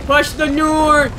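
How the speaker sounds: loud, drawn-out shout. Real, not acted. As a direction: yell it over the chaos. Shouting during chase.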